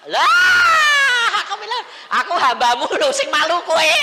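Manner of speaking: high outraged yell at God; hammed-up screaming complaint; shouted protest. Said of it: "Aku bilang itu". (teriakan protes yang didramatisir)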